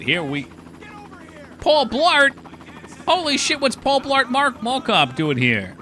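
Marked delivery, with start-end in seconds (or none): 1.6-2.3 loud high startled call; surprised shout. Real, not acted. Surprised shout at game character. 3.1-5.8 loud high disbelief, slowing; shocked exclamation. Real, not acted. Shocked outburst at character.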